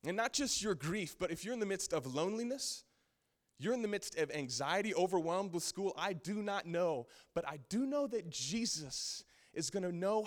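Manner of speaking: loud, high impassioned preaching; preaching at full force. Acted.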